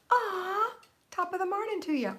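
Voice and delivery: high, loud mock Irish brogue with a gasp; hammed-up Irish lilt, pitched well up; mock Irish greeting. Greeting a viewer from Ireland in mock Irish brogue. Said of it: "I had Irish nuns".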